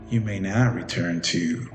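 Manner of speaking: ordinary delivery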